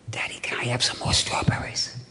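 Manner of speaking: put-on hushed, whispered child's request; little girl whisper. Four-year-old daughter asks daddy for strawberries, whispered. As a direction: whisper it like a small girl asking sweetly.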